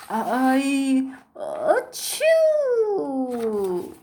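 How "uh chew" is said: teary sneeze. Acted. Gerald sneezes mid-sentence.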